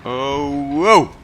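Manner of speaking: loud, high startled "Oh, whoa!". Real, not acted. Pilot gamer caught by a wild manoeuvre, real startled shout. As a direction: thrown by a sudden jolt. Plane suddenly flips in game.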